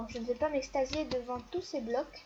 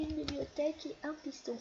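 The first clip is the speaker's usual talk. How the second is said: ordinary delivery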